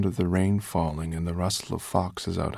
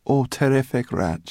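higher, grumbling sarcasm; sour, raised-pitch aside; muttered sarcasm; 8 semitones higher, 7 dB louder than usual. A narrator's reading. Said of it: "she muttered".

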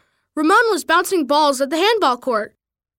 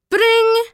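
high, ringing bell noise; imitated school bell; 8 semitones higher, 4 dB louder than usual. A narrator's reading.

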